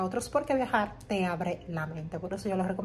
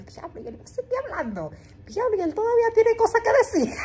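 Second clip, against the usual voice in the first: rising into laughter, much higher and louder; amazed laughing thought; 13 semitones higher, 5 dB louder, faster than usual. Retold or imitated. Telling viewers about the talker, laughing amazed. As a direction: laugh in disbelief that he keeps talking. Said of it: "y yo".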